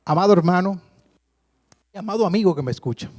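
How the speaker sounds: preacher's fervent altar call. Acted. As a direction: call out warmly to the congregation.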